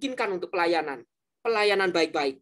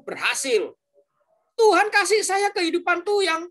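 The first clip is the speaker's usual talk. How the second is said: put-on higher, louder, a young man venting his grievance; angry complaint; 6 semitones higher, 4 dB louder than usual. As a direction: retell the young man's angry outburst with heat. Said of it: "Itu pemuda datang marah-marah".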